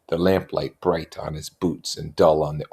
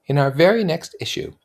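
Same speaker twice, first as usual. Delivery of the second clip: put-on higher young woman voice; Jordan voice; 11 semitones higher, 4 dB louder than usual. A narrator's reading. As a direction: lift into a light high voice.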